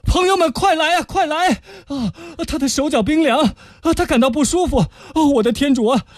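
high, urgent calls to the friends; panicked cry for help. Acted. Young man calls his friends for help as she faints, high and panicked. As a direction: shout for help, alarmed.

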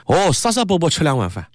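high-pitched, emotive Shanghainese exclamation; animated Shanghainese, pitched well up. Shown as a demonstration. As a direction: exclaim it in Shanghainese with exaggerated relish. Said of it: "上海话讲".

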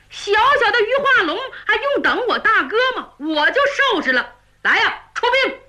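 brash general bragging with a laugh in it; boastful Niu Gao. Acted. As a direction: scoff at the enemy and call the troops out. 牛皋瞪眼夸口出兵. (牛皋不服气地夸口下令)

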